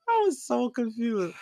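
high, slow, laughing through it; a real laugh lingering over the words; laughing confusion. Real, not acted. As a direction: stretch it out while still giggling. Still laughing after reaction.

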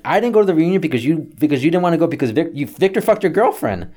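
high, fast retold argument; rapid acted self-defence; indignant rebuttal. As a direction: fire back in exasperation. Acted phone quarrel with dad.

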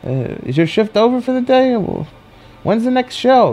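high imitated residents' voice; innocently curious, pitched well up; chatty residents. Unaware residents chat with him.

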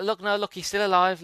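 high-pitched, insistent protest; retold pleading. Retold child pleading with mum.

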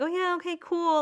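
high, light mock-friendly brush-off; politely insincere imitation; fake friendly. Imagined fake-friendly parting.

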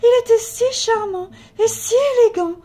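high, loud theatrical lament. A narrator's reading. Lady mourns her lost vampire lover, lamenting. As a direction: wail it like a heartbroken romantic.

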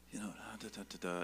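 soft unvoiced stammering; whispered hesitation. A husband freezing at the sight of a stranger, whispered. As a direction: trail off into a breathless whisper. Stuck for words, near the man.

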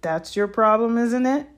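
high, loud gloating, lifting at the end; gleeful. A narrator's reading. Filch taunting the frightened pupils, gleeful. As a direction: relish their trouble with malicious glee. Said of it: "with his voice cracking with glee".

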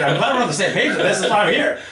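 high, loud, laughing sarcasm; mock agreement, laughing at the insult; sarcastic retort.

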